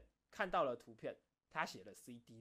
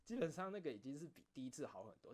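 ordinary talk, nothing marked

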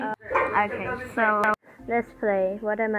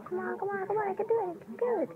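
anxious urging; 8 semitones higher, 5 dB quieter than usual. Real, not acted. Straining to reach before killed.

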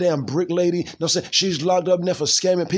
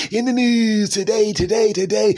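hyped news intro; 4 semitones higher, 5 dB louder than usual. Acted. Announcing news segment, hyped.

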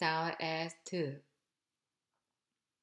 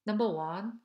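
ordinary delivery

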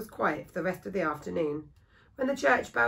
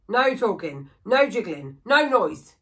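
louder barked commands, each one harder; stern orders; 2 semitones higher, 8 dB louder, slower than usual. Acted. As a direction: lay down the rules to the boy. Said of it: "he ordered Thomas".

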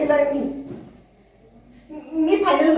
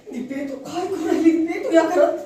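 agitated fretting; 6 semitones higher than usual. Acted. Dipa frets in a tense scene.

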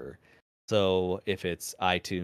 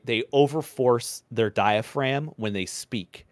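usual speaking voice

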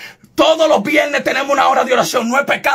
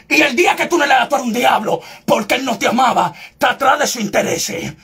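normal talking voice

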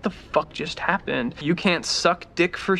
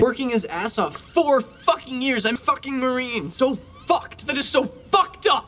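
shouting curses; 8 semitones higher than usual. Acted. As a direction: yell it out furiously. Tirade climaxes in shout.

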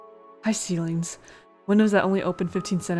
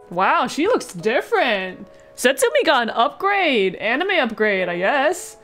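excited; 4 semitones higher, slower than usual. Real, not acted. Player seeing Setsumi's anime upgrade, excited. Reacting to new character art.

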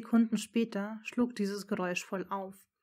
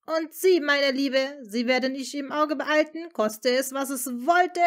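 loud, high, smug threat; 6 semitones higher, 9 dB louder than usual. Acted. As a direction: gloat over her with a grin. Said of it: "fügte er suffisant grinsend hinzu".

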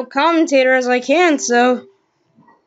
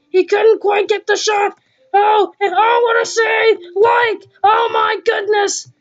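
frantic sports commentary; 6 semitones higher than usual. Pretend. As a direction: shout the goalmouth scramble breathlessly. Frantic scramble at the net.

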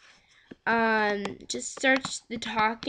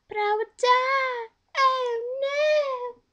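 much higher, squeaky mock dismay; hammed-up cartoon dismay, very high; squeaky bee panic; 4 dB louder, slower than usual. Bee voice realizes its fate.

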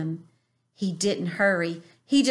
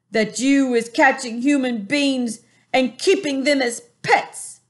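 booming giant; 5 semitones higher, 6 dB louder than usual. A narrator's reading. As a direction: boom it out like a bullying giant. Flesh lump eater voiced.

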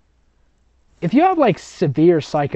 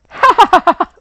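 loud, high fake cackle; a big hammed-up crazy laugh; forced mock laugh; 11 dB louder, faster than usual. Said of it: "the first one goes like".

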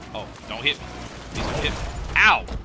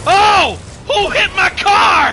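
high, loud, slow startled shout; hammed-up shocked shout; startled outcry; 13 semitones higher, 5 dB louder, slower than usual. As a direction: yell it as the car gets rammed. Car hit while fleeing.

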